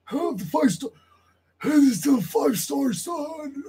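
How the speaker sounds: high, put-on announcer call with a shouted rise; Joey Ace impression. Mocking a rival wrestler's catchphrase in a shouted high voice. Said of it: "That's what Joey Ace does".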